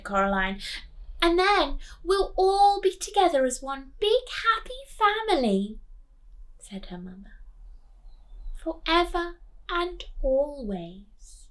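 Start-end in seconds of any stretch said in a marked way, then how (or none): 1.2-5.8 high, loud, gushing promise; high excited other mother. Acted. 8.7-11.5 high, slow, eerie promise; slow high eerie other mother. Acted.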